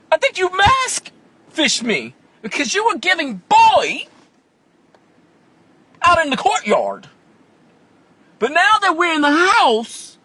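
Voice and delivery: high, loud, slowed outraged disbelief; mock outrage. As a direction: call him out loud and high. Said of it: "And I'm like".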